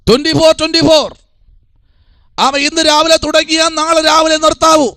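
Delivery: high-pitched, sustained pulpit delivery; impassioned preaching. Real, not acted. Preacher urges congregation to fast round the clock, impassioned preaching. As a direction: drive each phrase home on a high, held note. Preaches on fasting at full force.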